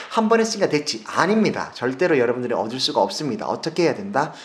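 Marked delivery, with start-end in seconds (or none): 0.1-1.0 mock complacent. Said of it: "보시기에".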